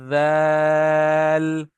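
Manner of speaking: वैल held very long, tongue tip under upper teeth; drawn-out dhal. Shown as a demonstration. Teacher demonstrating tongue-tip letters, slow held वैल. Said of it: "जबान की नोक लगेगी ऊपर वाले दांतों के".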